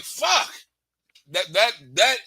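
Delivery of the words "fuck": a high, dropping fuck; mock stunned fuck; resigned fuck. Shown as a demonstration. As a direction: land it as the final verdict. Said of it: "your last one like".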